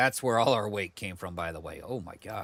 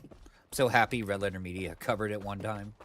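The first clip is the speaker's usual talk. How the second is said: normal talking voice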